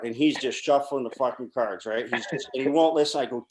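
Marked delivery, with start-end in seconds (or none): none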